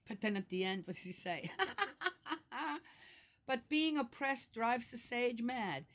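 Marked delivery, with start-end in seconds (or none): 1.5-5.8 amused, half-laughing reading. Real, not acted. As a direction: read the verse through real laughter. Laughs at the verse he reads.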